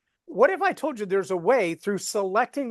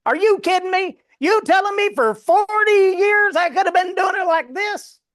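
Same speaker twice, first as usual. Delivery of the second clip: imitating a Southern YouTuber, much higher and louder; Southern accent; 12 semitones higher, 6 dB louder than usual. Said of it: "He's got a real Southern accent".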